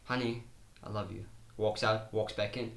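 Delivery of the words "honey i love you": much softer, lower sweet "Honey, I love you"; soft loving parent. Pretend. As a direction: coo it gently to a child. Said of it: "you're doing this".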